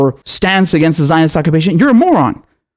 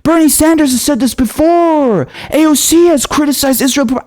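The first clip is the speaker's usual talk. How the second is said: mocking whiny protest; 9 semitones higher than usual. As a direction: parody their outraged objections, pitched up and dragged out. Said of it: "you're gonna have people saying".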